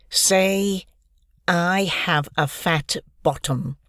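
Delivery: slow, menacing bully voice; demanding bully. Acted. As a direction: drag out the order like a bully leaning on a victim. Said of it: "demanded Pickle".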